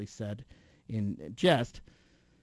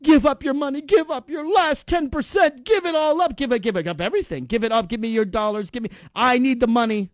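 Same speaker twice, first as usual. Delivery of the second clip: mock preacher; 7 semitones higher, 5 dB louder than usual. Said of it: "You got the pastor".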